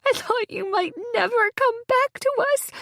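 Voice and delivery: high, quavering tearful voice. A narrator's reading. Sobbing friend at the bedside, tearful and high. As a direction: plead through tears. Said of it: "says through tears".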